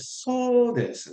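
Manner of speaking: "So desu" high and slow, voice rising; high lilting tone. Shown as a demonstration. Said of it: "your voice goes up".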